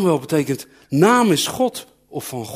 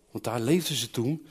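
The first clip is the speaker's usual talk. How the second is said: ordinary delivery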